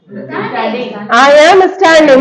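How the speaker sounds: drawn out, word by word; very slow dictation. Shown as a demonstration. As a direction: stretch the sentence for pupils. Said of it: "teacher dictating for class".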